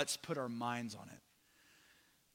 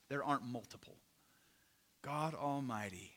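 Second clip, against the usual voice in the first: normal talking voice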